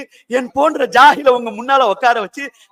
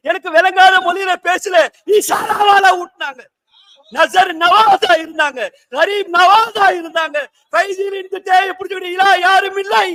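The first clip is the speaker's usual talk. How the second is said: raised, ringing sermon delivery; fervent preaching; 6 semitones higher than usual. Real, not acted. Preacher extolling the sheikh to the gathering, fervently. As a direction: preach it out with full force.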